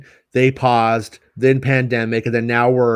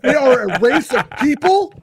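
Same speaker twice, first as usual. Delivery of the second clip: mock scolding; 8 semitones higher, 3 dB louder than usual. Mock stern scolding of cohost.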